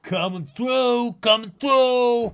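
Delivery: high, loud cartoon guard cry; squeaky guard alarm. Minion guard yelling to clear the way. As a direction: shout a warning in a squeaky minion voice.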